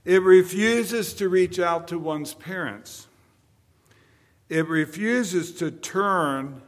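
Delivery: booming sermon delivery; full force preaching. Acted. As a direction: hammer each line home to the congregation.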